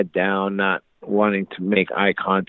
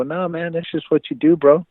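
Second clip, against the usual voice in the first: defensive backpedalling; 9 semitones higher than usual. Retold or imitated. Backing off from offended friend.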